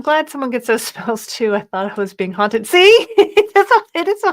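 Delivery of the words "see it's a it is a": high laugh breaking through. Real, not acted. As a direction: laugh mid-word. Laughing about being haunted.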